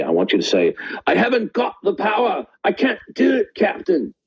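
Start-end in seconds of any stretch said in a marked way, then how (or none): 1.1-4.1 very high, intense Star Trek plea; high-pitched desperate engineer plea; Scotty impression. Shown as a demonstration. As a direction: cry it out high and desperate to the captain. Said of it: "say in a very excited and intense way".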